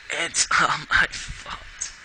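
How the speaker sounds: slow, whispery self-blame through tears; holding back sobs. A narrator's reading. Pony blaming herself at the hospital bed, tearful whisper. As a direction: let the breath swallow the words, very slowly. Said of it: "gritted her teeth against the sobs".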